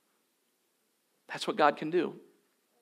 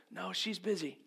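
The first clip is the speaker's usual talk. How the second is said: put-on much softer, hushed small-boy answer; quiet little boy; 3 semitones higher, 8 dB quieter than usual. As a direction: murmur it like a child keeping a secret. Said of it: "the little boy quietly says".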